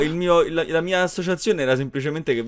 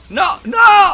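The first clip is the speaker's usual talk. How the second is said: panicked yell, much higher; shouting; 10 dB louder than usual. Real, not acted. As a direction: shout no in alarm. Panic in the fight.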